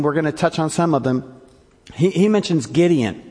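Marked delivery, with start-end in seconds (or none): none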